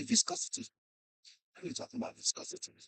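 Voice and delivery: barely audible mumbled lecture; near-whispered physics teacher impression; inaudible teacher. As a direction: imitate a teacher nobody can hear. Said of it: "We didn't hear what he's saying".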